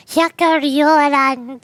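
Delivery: Hyakuryoran said slowly, syllable by syllable; slow careful pronunciation. Shown as a demonstration. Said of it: "this is so hard to say".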